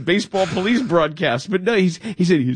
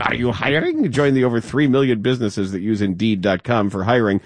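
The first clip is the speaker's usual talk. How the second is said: lower, smooth sponsor read; 6 semitones lower than usual. Acted. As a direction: read it like a radio ad. Reads a sponsor ad.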